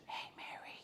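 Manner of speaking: sharp whisper to get a sister's notice. Retold or imitated. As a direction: hiss her name under your breath. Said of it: "trying to get Mary's attention".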